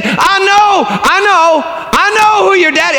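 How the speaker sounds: high, loud insistent call; loud preacher. Acted. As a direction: announce it to the whole room. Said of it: "he said really loud".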